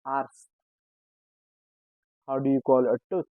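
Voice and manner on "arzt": Arzt said "ars"; Arzt, z sounded as s; German pronunciation. Shown as a demonstration. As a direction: end Arzt in a hissed s. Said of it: "we pronounce it with S".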